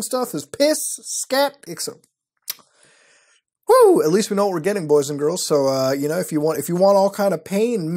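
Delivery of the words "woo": loud, high whoop of glee; excited whoop. Real, not acted. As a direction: let out a big high woo. Reacts to outrageous listing.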